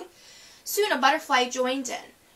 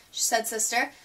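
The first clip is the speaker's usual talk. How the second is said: ordinary delivery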